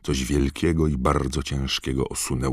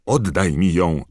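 desperate plea; 4 semitones higher, 6 dB louder than usual. A narrator's reading. Harry pleading in dream.

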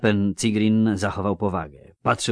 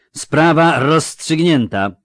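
loud, higher, drawn-out proclamation; booming announcement; 9 semitones higher, 11 dB louder, slower than usual. A narrator's reading. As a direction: announce it slowly to the whole tavern. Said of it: "ogłosił gromko".

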